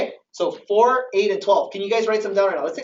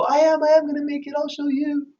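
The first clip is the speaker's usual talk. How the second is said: higher, defiant mock reply; defiant comeback; 6 semitones higher than usual. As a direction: throw it back at them, stubborn. Defiant reply to doubting family.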